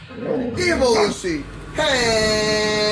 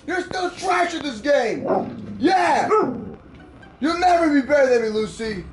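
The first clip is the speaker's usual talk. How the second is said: gloating shout; 2 semitones higher, slower than usual. Real, not acted. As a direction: rub the win in the dog's face. Gloating after a win.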